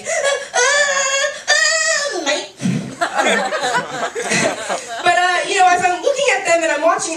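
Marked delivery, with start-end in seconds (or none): 0.0-2.5 high mock wail with an Australian twang; hammed-up Australian toddler wailing; Australian baby crying. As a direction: bawl like a baby, then drop to an Australian "mate". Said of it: "they're Australian. It was more like".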